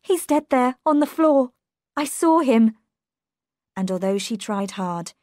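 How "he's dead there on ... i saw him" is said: high, loud and distraught; raised, panicky voice; shocked. A narrator's reading. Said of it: "could not stop shaking".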